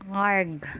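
loud, lightly voiced pirate growl of "arg". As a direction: give a mock pirate "arg". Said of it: "stereotype pirate would say it".

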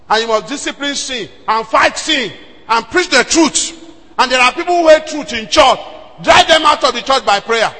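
fiery preaching; full-force preaching. Acted. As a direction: preach it hard.

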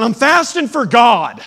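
high, hammed-up yell; mock angry shout. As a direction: shout it as a mock excuse for being mean. Acts out snapping at concerned people.

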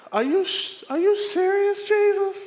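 squeaky high disbelieving cry; disbelief. Retold or imitated. Pastor asking Jesus if he's serious, high squeal. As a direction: squeal it in shock. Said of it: "pastor protesting to God".

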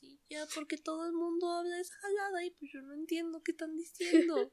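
small-voiced exasperated student; overwhelmed learner. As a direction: plead softly like a lost beginner. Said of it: "Y tú dices".

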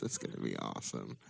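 low, deadpan mock enthusiasm; dry sarcastic aside. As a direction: say it like you know it won't be. Sarcastic quote about convent summer.

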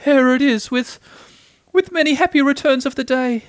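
high, small, halting voice; falter, then finish the greeting high; Piglet, sorry birthday offering. A narrator's reading.

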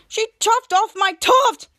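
slow, loud, high wail of protest; mock outrage. Evil Schwoz after his tuft is cut, mock outrage. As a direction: draw out each word in acted indignation. Retold outrage at chopped hair.

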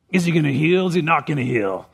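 put-on voice of suspicious religious leaders; scowling Pharisees. Religious leaders watching Jesus on the Sabbath, scowling suspicion. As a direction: mutter it like a watchful, disapproving critic. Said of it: "I don't know if that's how they talked".

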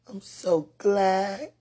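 slow, loud, high exclamation; drawn-out happy exclaim; joyful. Real, not acted. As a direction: savour the gladness. Joyful declaration.

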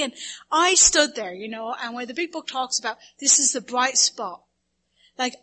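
normal talking voice

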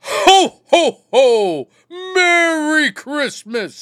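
booming high jolly ho ho ho; Santa impression. Shown as a demonstration. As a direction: belt out a big, slow, jolly Santa greeting. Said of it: "You wanna see how it's done?".

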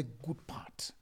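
slow whisper. Acted. Preacher whispers the key point to the congregation. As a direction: lean in and whisper it.

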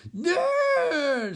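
high, loud drawn-out shriek. Acted. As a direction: shriek NO in refusal. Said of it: "shrieking balls".

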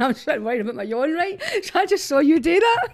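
high laughing burst. Real, not acted. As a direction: blurt it out laughing. Laughs catching friend yawning.

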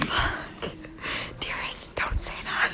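soft, voiceless whisper into the mic; hushed playful ASMR talk. Pretend. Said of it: "let's just do some A S M R talking".

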